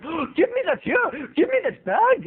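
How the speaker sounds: high, demanding mock Indian accent; hammy Indian-accented shop owner. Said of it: "giving this guy an Indian accent".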